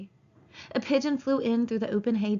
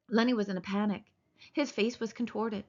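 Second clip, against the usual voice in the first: usual speaking voice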